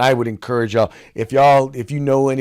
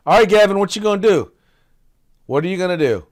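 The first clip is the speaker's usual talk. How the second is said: higher, louder mocking challenge; taunting; 7 semitones higher, 6 dB louder, faster than usual. Real, not acted. Host taunts Gavin over the deepfake law, loud mocking dare. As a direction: goad him, daring him to respond. Taunting Newsom after the troll clip.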